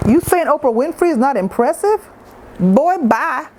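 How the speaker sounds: playful outrage, pitched high; mock indignant. Real, not acted. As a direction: scoff at the uncle in disbelief.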